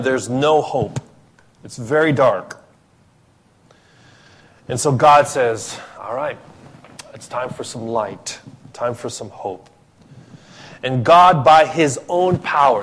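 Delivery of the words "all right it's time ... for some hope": softer, tender promise of light; gentle God. Acted. Preacher voicing God comforting his scattered people, softly. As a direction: quietly reassure a people in the dark. God voiced, offering hope.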